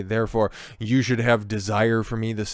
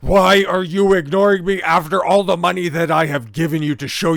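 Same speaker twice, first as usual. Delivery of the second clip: loud, higher furious yelling of the text; reading an all-caps rant; 6 semitones higher, 6 dB louder than usual. Retold or imitated. Vlogger reads the guy's angry all-caps texts to Ruby, yelling. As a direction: shout it like the caps lock is on. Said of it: "All in capitals".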